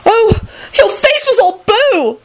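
very high, slow little squeal; high-pitched shrieking girl. A narrator's reading. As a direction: shriek it with drawn-out words. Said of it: "Elizabeth shrieked".

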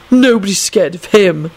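mildly rude, dismissive retort. A narrator's reading. Young man dismisses someone to his companion, rudely. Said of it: "Neville replied rudely".

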